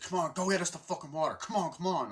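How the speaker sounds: mock bossy yell. Soldiers bossing the rookie around.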